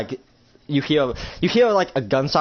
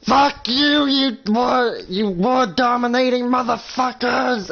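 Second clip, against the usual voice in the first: furious battle yell; 11 semitones higher, slower than usual. Acted. Woman at attackers amid explosions, furious yell. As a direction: scream abuse at the enemy.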